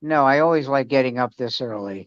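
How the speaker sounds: low, grumbled retort, imitating her; grumpy sarcasm. Daughter answering dad's morning question, grumpy sarcasm. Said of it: "Why are you so grumpy".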